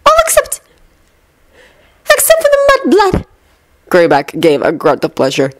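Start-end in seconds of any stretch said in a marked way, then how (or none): 0.1-3.2 high, cold witch voice; Bellatrix voice. A narrator's reading. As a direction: sneer out the last word.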